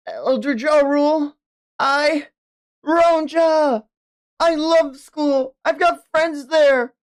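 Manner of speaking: put-on high child character voice; earnest young child. Child pleading to an elder about school, high and earnest. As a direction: pitch it up like an earnest kid.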